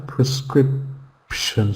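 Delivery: slow, soft "prescription" with weak first and last syllables; careful model of "prescription", schwa at both ends; reduced schwa syllables. Shown as a demonstration. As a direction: say "prescription" quietly, pausing before "shun". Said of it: "first and the last syllable has a schwa".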